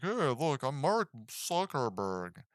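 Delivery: slow, odd put-on voice, lightly done; a hint of a stilted tech-founder impression; weird Zuckerberg voice. Reviewer mimics an actor's weird voice for Zuckerberg, slow and stilted. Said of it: "a weird voice as well".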